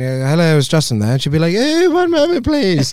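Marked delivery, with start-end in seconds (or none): 0.3-1.1 a light mock British accent, a bit louder. Caller on the phone to mother, light British accent. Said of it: "you had a British accent". 1.5-2.9 much higher, polished phone-answering voice; mother's phone voice. Retold or imitated. Mother answering a British caller, high polite phone voice. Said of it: "Code switching".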